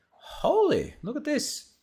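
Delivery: shocked surprise. Real, not acted. Shocked by website traffic stats.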